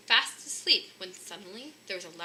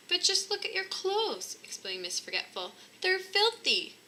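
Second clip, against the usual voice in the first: much higher, shocked exclamation; wife dismayed at Santa's sooty clothes; appalled; 10 semitones higher than usual. Acted. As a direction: gasp at the mess.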